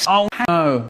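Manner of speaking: ordinary delivery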